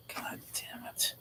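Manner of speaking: hushed, annoyed whisper; whispered cursing. Real, not acted. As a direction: curse under your breath. Cursing own mistake to himself.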